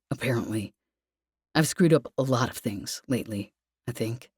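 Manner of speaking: low, sorry, trailing off; Lev, apologetic. Acted. Lev confesses.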